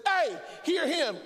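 high, drawn-out commanding yell; yelling from heaven. Acted. A voice from heaven yells at you, commanding. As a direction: yell it down at them sharply. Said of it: "yells at you".

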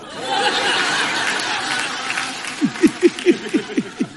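real laugh with little voice; long laugh. Real, not acted. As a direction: burst out laughing at your own dream. Laughing at his Rhett Butler dream.

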